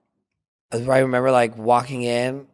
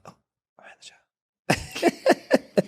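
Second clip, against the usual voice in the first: slow, giddy mock whisper; retold whisper breaking into laughter; whispered realisation; much slower than usual. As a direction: whisper it slowly as it sinks in.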